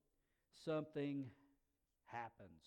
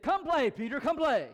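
high, loud, playful shout; hammed-up joyful summons; Jesus calling playfully; 10 semitones higher, 15 dB louder than usual. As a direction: holler an invitation to a playmate. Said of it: "And Jesus said".

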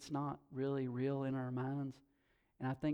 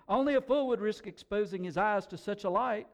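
raised, louder dismissal; scornful refusal; 6 semitones higher, 6 dB louder than usual. A narrator's reading. Cave dweller dismissing the stranger's light, scornful. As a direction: scoff at the idea with force.

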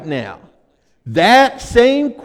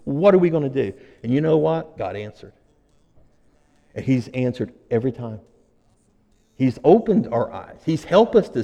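softer, intimate confession; quiet testimony; 6 semitones lower, 4 dB quieter than usual. Real, not acted. Pastor quietly recalling hard times to church, soft and sincere. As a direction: bring it down and share it sincerely.

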